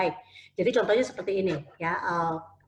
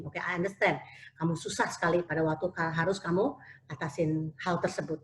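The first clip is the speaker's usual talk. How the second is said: understanding parent; 3 semitones lower, 7 dB quieter than usual. Retold or imitated. Parent validating child's feelings softly.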